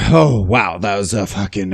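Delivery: normal talking voice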